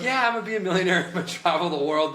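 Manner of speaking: fast, high, laughing boast; mock naive dreamer. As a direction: send up your younger self gushing. Mocks his own naive dreams.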